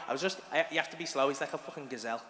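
normal talking voice

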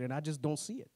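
ordinary talk, nothing marked

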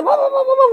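rapid mock barking, pitched very high; imitación de ladridos. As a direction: imitate a yappy dog's barking. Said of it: "se la pasa". (ladridos imitados, agudos y seguidos)

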